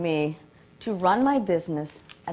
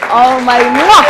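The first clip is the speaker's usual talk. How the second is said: loud blown kiss; 6 semitones higher, 15 dB louder, slower than usual. Real, not acted. Blowing a kiss to a colleague.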